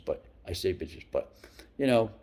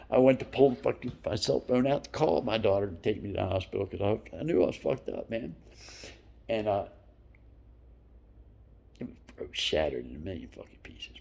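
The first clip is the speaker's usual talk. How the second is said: ordinary delivery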